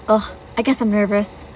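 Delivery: plain reading voice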